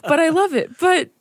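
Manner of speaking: laughing through the words, giddy; overwhelmed but delighted, laughing. Retold or imitated. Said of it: "I'm like".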